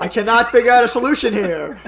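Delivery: hammed-up helpless shopper; mock panic. Joins mock panic at checkout.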